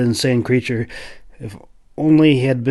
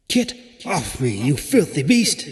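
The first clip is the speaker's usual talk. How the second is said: sharp unicorn voice; 3 semitones higher, 4 dB quieter than usual. A narrator's reading. Unicorn speaking into the Clove's head, sharp. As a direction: speak as a haughty creature in his head. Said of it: "very sharp, intrusive voice".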